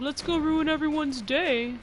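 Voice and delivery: high, slow sing-out of a troublemaker; mock enemy gang. Mimics enemy groups' gleeful plan.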